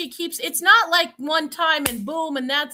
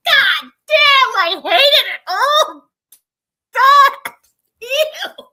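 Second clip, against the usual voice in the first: disgusted; 7 semitones higher, 8 dB louder, much slower than usual. Real, not acted. Revolted by the denture scene.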